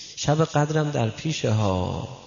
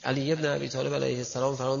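ordinary delivery

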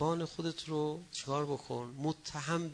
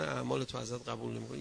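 normal talking voice

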